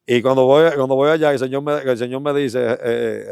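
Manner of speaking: normal talking voice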